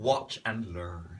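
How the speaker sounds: low, slow, cool delivery; cocky retort. Acted. Host supplying a guest's retort, cocky.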